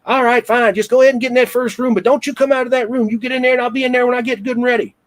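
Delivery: put-on very high, fast cranky old-woman voice; put-on high-pitched scolding; nasty old nurse, imitated. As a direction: imitate a nasty elderly nurse ordering people about. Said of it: "Just real nasty".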